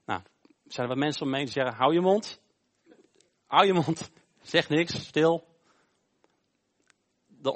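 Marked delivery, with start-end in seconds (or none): 1.8-5.5 raised, louder telling-off; acted silencing. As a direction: shut him up in short, firm commands. Bystanders silencing the shouting blind man. (iemand luid de mond snoeren)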